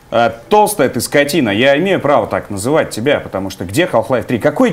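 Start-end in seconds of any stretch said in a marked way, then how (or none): none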